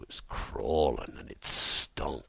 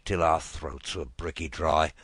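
plain reading voice

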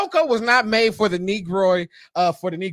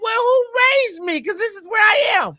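high, hammed-up protest; indignant comeback; 11 semitones higher, 3 dB louder than usual. Son answering his mama back, indignant. Acts out shouting back at mother.

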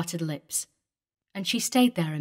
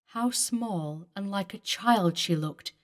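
plain reading voice

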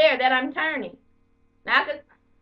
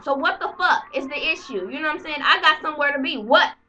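ordinary talk, nothing marked

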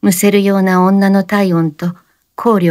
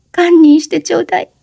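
high anguished cry; desperate fast cry; 12 semitones higher, faster than usual. A narrator's reading. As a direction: cry out for forgiveness. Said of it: "おしのは叫ぶように言って".

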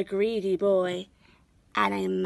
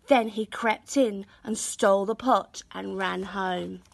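ordinary delivery, nothing marked

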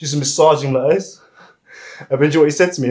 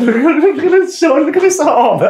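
excited exclaim; 13 semitones higher, 6 dB louder than usual. Real, not acted. Masseur showing viewers the client's loose shoulder, excited and amused. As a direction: crow over the result, laughing.

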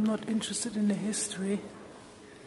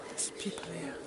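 whispering; 3 semitones lower, 10 dB quieter, faster than usual. Real, not acted. Whispering near other visitors.

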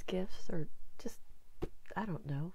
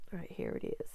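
whisper; 8 dB quieter than usual. Real, not acted. Found it, talking to self.

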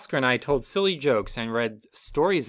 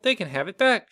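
higher, light motherly voice; mother kangaroo's voice; 9 semitones higher, 3 dB louder than usual. Acted. Mother kangaroo thinks about returning the baby, pitched up. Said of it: "she thought".

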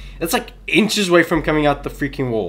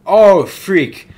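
sudden loud, higher yelp of fright; real startled outcry; 6 semitones higher, 10 dB louder than usual. Real, not acted. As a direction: jump at the scare. Sudden scare in horror game.

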